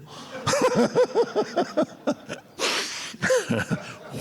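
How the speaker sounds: long high breathless real laugh. Real, not acted. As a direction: crack up at the story. Laughs at the retold story.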